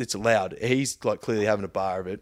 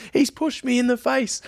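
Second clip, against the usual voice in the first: high-pitched mock outcry; much higher, aggrieved mimicry; protesting passenger; 13 semitones higher, 3 dB louder than usual. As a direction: imitate her loud accusation to the whole cabin. Imitating the irate passenger protesting.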